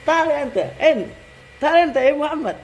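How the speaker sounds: calling someone over. Shown as a demonstration.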